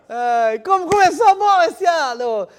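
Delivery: over-the-top ironic joy; sarcastic cheer. Acted. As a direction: gush about the great year, sarcastically. Mock-cheerful New Year exclamation.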